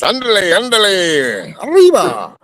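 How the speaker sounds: high-pitched, drawn-out excited whoop; hammed-up cartoon "ándale" call; cartoon Mexican cheer. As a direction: stretch each cry out high, letting it fall away. Calling out cartoon-style Mexican catchphrases.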